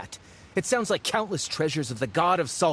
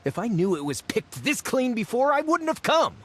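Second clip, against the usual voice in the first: petulant whine climbing much higher at the end; whiny complaint; 6 semitones higher than usual. Acted. Whiny grumble to the group about a wasted trip. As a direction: sulk it out, rising to a pout. Complaining the ruins were looted.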